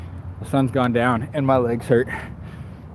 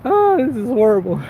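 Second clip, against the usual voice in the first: pained groan; 9 semitones higher than usual. Real, not acted. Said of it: "I'm getting a cramp in my foot".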